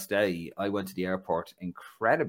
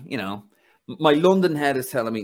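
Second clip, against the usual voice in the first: normal talking voice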